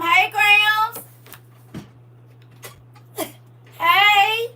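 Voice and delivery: high, loud, drawn-out hello; calling out a greeting. Real, not acted. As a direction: sing out a big hello to grandma. Calling a greeting to listener's grandmother.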